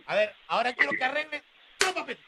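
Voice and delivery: scolding father. Acted. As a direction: scold the son quickly. Voz de padre regañando. (padre regañón)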